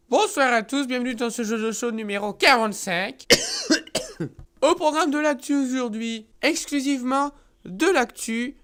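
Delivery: upbeat news anchor voice; TV host. Acted. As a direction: announce the headlines like a TV host. Host opens the show.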